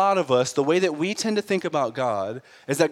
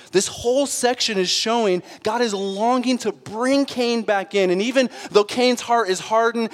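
high, loud preaching build; rising full-voiced sermon delivery; 6 semitones higher, 4 dB louder than usual. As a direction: preach it to the congregation.

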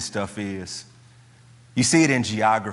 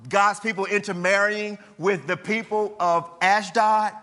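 rising, fervent preaching voice, higher and building; 7 semitones higher than usual. Acted. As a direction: drive it home to the congregation. Builds the map of enemies.

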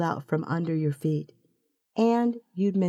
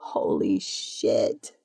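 very slow, low, drawn-out exclamation; happily stunned; 10 semitones lower, much slower than usual. A narrator's reading. Naomi absorbing the offer to leave together, happily stunned. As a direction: let it out low and long, amazed. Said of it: "She sounds happy".

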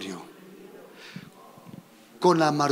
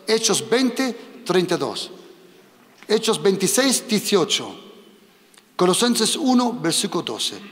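teacher dictating verse numbers to a volunteer, slow and clear; slow dictation. Shown as a demonstration. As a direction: dictate the verses slowly for the scribe. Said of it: "Otra vez".

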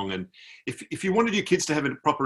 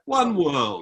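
higher-pitched drawn-out plea; imitating emotional soldier; 9 semitones higher than usual. Soldier repeats his plea for unity, imitated emotion. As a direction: mimic a heartfelt tipsy appeal. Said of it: "he got quite emotional".

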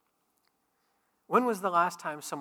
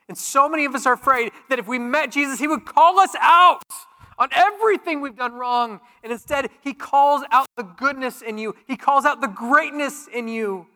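loud, high, driving sermon voice; full-force preaching; 9 semitones higher, 10 dB louder than usual. Acted. Pastor to congregation on Jesus calling out goodness, full-force preaching. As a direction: preach it out with rising fire.

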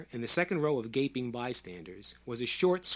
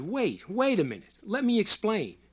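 raised, higher pleading call; mild shout; 7 semitones higher, 4 dB louder than usual. A narrator's reading. Doctor trying to explain to the crowd, mild shout. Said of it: "frantically waved his arms and shouted".

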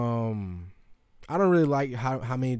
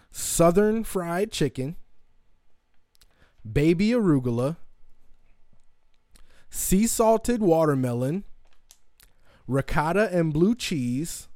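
normal talking voice

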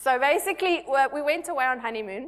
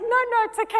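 1 husband reassuring; 11 semitones higher, 5 dB louder, faster than usual. Retold or imitated. Husband comforting her.

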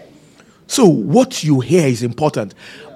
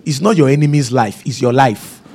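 ordinary talk, nothing marked

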